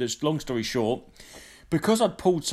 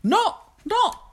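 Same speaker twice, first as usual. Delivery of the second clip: mock squeak; 4 dB louder than usual. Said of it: "Like that".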